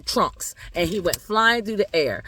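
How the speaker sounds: ordinary delivery, nothing marked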